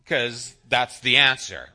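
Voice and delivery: normal talking voice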